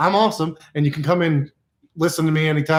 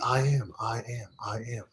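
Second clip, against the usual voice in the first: low and much softer, dying away; whispered-down mantra; 5 semitones lower, 12 dB quieter than usual. Shown as a demonstration. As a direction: let the I am fade almost to nothing. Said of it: "you just repeat".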